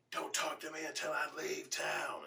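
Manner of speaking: loud, high mock yell. Son lashing out at his dad, high imitated yell. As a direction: imitate an angry kid yelling. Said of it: "he yelling at the point".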